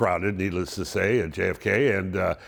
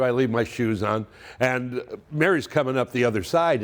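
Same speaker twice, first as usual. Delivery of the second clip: normal talking voice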